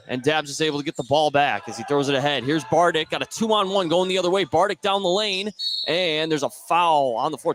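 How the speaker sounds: excited courtside call of the fast break; play-by-play call. Acted. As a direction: call the drive and the kick, rising.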